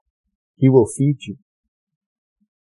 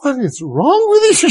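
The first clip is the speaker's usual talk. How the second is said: wife's mock-exasperated question, pitched higher; teasing wife; 14 semitones higher, 4 dB louder than usual. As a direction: imitate the wife teasing him for crying. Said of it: "She would tell me".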